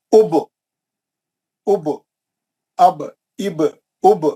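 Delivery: اب said as "ub" with pesh, rounded lips; reading aloud slowly. Shown as a demonstration. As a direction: read اب as "ub", short u then ba joined. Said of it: "اگر یہاں پیش ہے تو". (اب کو پیش کے ساتھ "اُب" پڑھنا)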